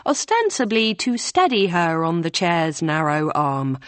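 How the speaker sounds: usual speaking voice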